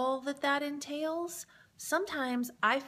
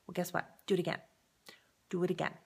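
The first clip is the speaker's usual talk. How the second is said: normal talking voice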